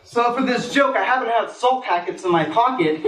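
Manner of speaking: ordinary talk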